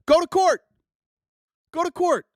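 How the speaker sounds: high, loud, fed-up demand; exasperated shout. Real, not acted.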